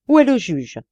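loud, high hoarse demand. A narrator's reading. Frantic prisoner demands the judge, loud hoarse demand. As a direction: bark the question wildly. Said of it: "d'une voix rauque".

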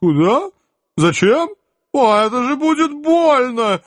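high, loud squealing protest; protesting wail. Acted. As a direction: wail in panic. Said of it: "вопли протеста".

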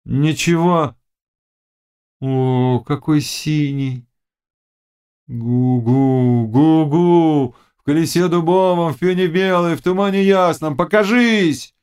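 high chanted spell, building; witch's incantation. A narrator's reading. Witch calling spirits from the wheel, chanting.

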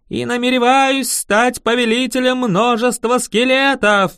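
high, loud, grandiose dark-lord boast; villain act. A narrator's reading. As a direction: ham up the evil necromancer. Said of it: "Я вошёл в роль".

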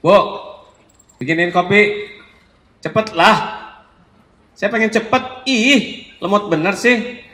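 loud, high impatient demand for coffee; rude husband. Shown as a demonstration. Husband ordering wife for coffee, loud high impatient rudeness. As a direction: nag her to hurry, rudely. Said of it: "Coba ibu disuruh oleh suami begini".

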